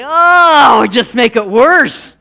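loud, high, drawn-out oh; hammed-up wail, stretching the last words; mock wail. Wife hearing useless comfort, loud mock wail. Imagined reaction to clumsy comfort.

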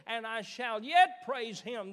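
triumphant preacher's shout; shouted scripture. Acted. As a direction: proclaim it at the top of the voice.

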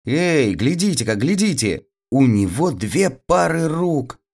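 high, lightly acted crowd cry; storyteller's startled call; astonished cry. A narrator's reading. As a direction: exclaim high, pointing out a marvel. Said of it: "закричали все вокруг".

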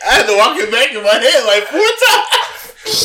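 high-pitched, talking through laughter; laughing hard. Real, not acted. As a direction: crack up while getting it out. Cracking up at own misheard joke.

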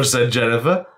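ordinary delivery

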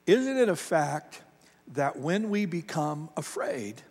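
ordinary talk, nothing marked

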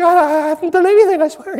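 high-pitched, loud stammering denial; hammed-up squealing panic; mock freaking out. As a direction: play a panicked pastor protesting innocence. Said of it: "he's, he's freaking out".

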